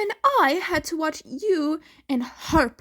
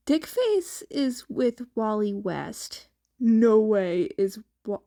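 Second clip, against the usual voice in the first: ordinary delivery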